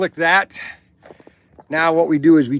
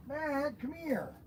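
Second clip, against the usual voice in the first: soft call; 7 semitones higher, 15 dB quieter, slower than usual. Real, not acted.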